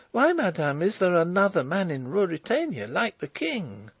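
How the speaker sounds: put-on high, girlish telling; earnest farm girl. A narrator's reading. Farm girl explaining to the princess what she saw, earnest. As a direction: explain it in a high, eager girl's voice.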